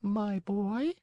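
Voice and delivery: calm, peaceful snail voice. A narrator's reading. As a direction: soothe him unhurriedly. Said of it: "in a calm, peaceful voice".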